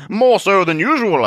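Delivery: high, slow, stately remark; note it with affected gravity; grandly concerned crusader; observing Zealot. Acted. Zealot comments on Truth.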